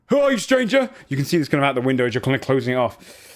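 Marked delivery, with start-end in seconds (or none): 0.1-0.9 guard challenging. Acted. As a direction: call out sharply at the strangers. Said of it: "they kind of point and go".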